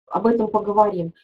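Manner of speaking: ordinary delivery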